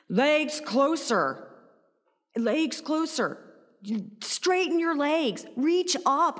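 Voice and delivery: loud, high, drawn-out commands; imitated teacher's yell. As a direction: mimic a yoga teacher calling out corrections. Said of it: "he yelled at me the entire time".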